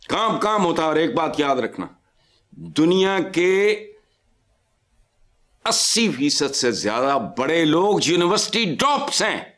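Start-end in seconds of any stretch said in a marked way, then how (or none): 2.5-9.4 slow, weighty point; slow emphatic. Real, not acted. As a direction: drag out each word for emphasis. Stressing a lesson to the young.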